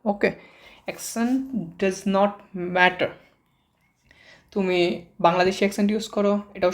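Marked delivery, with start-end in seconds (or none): 0.9-3.1 accent does not matter, each word drawn out slowly; slow, deliberate teacher's emphasis on not; slow emphatic statement. Shown as a demonstration. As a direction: lay the point down slowly so it sinks in. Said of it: "teacher driving home the point".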